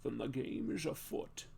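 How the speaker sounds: low-key mock Scottish burr; rough, quiet stab at a Scottish lisp; Sean Connery. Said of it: "how to do a Sean Connery accent".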